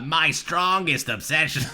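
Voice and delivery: slow, drawn-out mock Boston read; mocking Boston accent. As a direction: stretch it like a hammed-up Boston speaker. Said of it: "the way you're saying, you're like".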